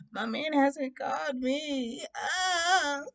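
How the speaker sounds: high, drawn-out fake crying; mock distraught. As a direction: wail slowly like a lovesick drama queen. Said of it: "I was just so distraught".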